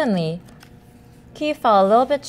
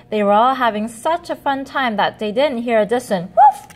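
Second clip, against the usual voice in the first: ordinary delivery, nothing marked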